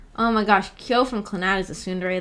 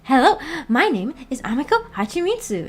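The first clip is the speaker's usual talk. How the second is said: a light, higher cutesy voice; a hint of a higher girly voice; Amiko impression; 5 semitones higher than usual. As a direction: try her friend's sweet intro again. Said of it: "Is that how she talks?".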